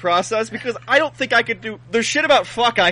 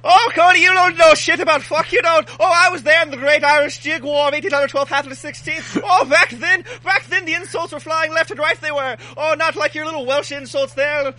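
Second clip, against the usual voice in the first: hammed-up fake Irish accent, pitched higher; bad Irish accent; 8 semitones higher than usual. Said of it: "extravagant tales in an Irish accent".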